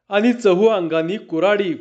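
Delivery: usual speaking voice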